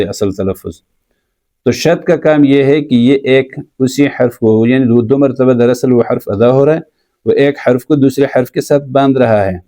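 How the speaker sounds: usual speaking voice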